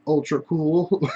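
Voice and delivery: drawn-out and laughing, self-mocking. Real, not acted. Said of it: "Trying to be".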